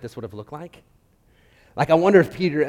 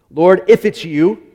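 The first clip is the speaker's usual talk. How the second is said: calling out; 6 semitones higher, 14 dB louder, slower than usual. Acted. Peter calling out on water.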